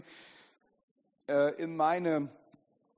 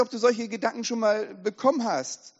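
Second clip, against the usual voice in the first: ordinary delivery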